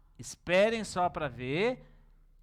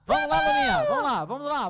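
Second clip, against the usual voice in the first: excited call; 13 semitones higher, 5 dB louder, faster than usual. Acted. Chama as crianças para a aula.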